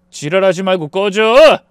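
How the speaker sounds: building to a loud final bark; angry dismissal. A narrator's reading. Husband telling ex-wife to get lost, angry. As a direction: spit out the order to get lost.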